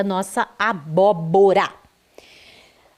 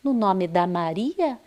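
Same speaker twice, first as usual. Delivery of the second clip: ordinary delivery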